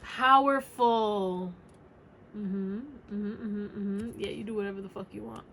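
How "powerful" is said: slow and louder, weighty; emphatic drawn out. Acted. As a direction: lean hard on every syllable of Powerful. Emphatic card reading.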